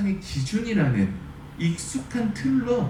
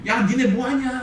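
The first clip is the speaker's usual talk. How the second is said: higher-pitched taunting call, only half shouted; mock jeering shout; 6 semitones higher than usual. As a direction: imitate a soldier calling down, pitched up. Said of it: "막 소리치고".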